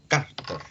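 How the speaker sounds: "Cárter" with the stress on the first syllable, CÁR-ter. Shown as a demonstration. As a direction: lean on the "á" of "Cárter". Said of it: "Cárter es con acento en la A".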